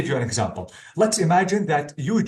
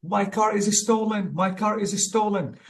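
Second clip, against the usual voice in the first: mock scream; 6 semitones higher than usual. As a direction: act out screaming without raising the volume. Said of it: "You want to scream".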